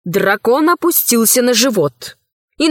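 usual speaking voice

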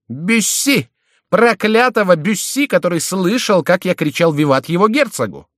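very high, loud indignation; high-pitched, infuriated complaint; outraged cursing. A narrator's reading. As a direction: burst out with the hated name. Curses the enemy who heard him.

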